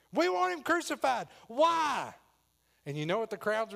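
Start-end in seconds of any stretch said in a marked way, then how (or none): 0.1-1.3 loud, high crowd yell; mob demand. Acted. Crowd answering Pilate, loud demanding yell. 1.5-2.1 high, loud challenge; loud protest. Acted. Pilate questioning the crowd, loud protest.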